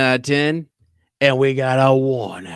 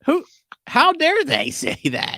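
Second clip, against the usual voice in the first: mock outrage; 3 dB louder than usual.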